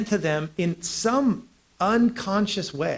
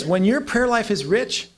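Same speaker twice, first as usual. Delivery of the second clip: ordinary talk, nothing marked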